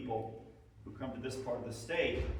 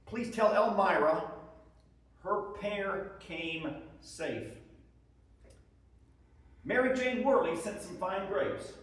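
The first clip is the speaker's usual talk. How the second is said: higher, louder, earnest soldier writing home; soldier's letter; 7 semitones higher, 4 dB louder than usual. Acted. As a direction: read it as a homesick Union private. Reads soldier husband's letters aloud.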